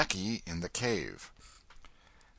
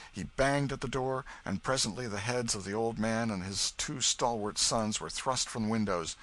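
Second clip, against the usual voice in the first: plain reading voice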